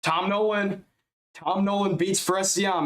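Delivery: high, slow ring-announcer call of the winner; excited announcement. Real, not acted. Announcing the judges' decision on Nolan, excited. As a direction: belt out the winner's name.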